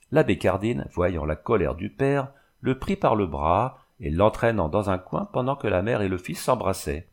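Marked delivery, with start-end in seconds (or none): none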